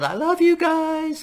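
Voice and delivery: mock [name]. Fan imitating the singer running down the field, gushing high squeal. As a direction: coo it like a starstruck pop star on the field. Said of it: "She ran down the field".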